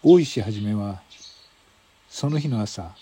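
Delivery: normal talking voice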